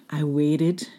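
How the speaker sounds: ordinary delivery, nothing marked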